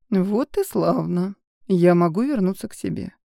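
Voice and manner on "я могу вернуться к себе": ordinary delivery, nothing marked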